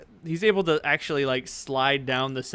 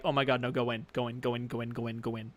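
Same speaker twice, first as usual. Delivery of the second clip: softer, low, repetitive muttering; Rook urging them on; 3 semitones lower, 7 dB quieter, faster than usual. Acted. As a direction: hustle them through under your breath. Rook hurries smelly party past.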